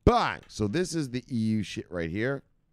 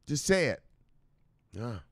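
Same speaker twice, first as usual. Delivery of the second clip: ordinary delivery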